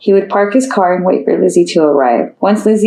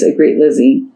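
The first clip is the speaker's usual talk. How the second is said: usual speaking voice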